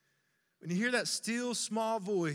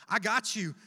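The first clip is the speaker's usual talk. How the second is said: louder, confident promise; firm reassurance; 5 dB louder than usual. Retold or imitated. Jesus reassuring the pastor, firm and louder. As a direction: repeat it with conviction. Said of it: "This is what Jesus says to me".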